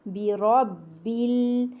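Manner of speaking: बी रॉ बिल drawn out slowly, letter by letter; slow recitation. Shown as a demonstration. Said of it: "साकिन करेंगे".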